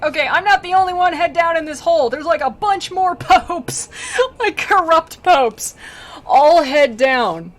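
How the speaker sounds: high, loud comic rant; hammed-up shouting, slowing for emphasis; mock indignant complaint. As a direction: holler it up from a hole, laughing. Acted damned pope's complaint.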